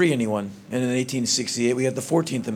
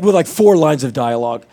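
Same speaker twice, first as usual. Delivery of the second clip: ordinary talk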